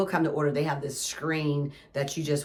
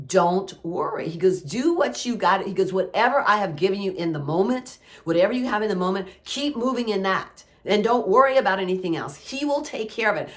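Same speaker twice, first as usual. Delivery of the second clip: voicing God; 4 semitones higher, 5 dB louder than usual. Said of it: "he says".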